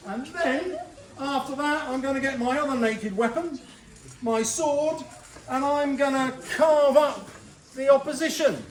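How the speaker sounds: hammed-up macho bragging; cocky boast. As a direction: puff up like a lad showing off his sword. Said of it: "Samson's like".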